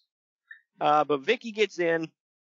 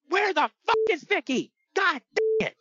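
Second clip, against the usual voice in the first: much higher mock yell; Eddie shouting. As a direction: yell it in a high, strained voice. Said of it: "Eddie shouts".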